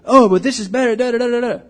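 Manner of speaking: high, loud whiny counter-argument; mock the employee who always knows better; know-it-all employee. Employee arguing back in example.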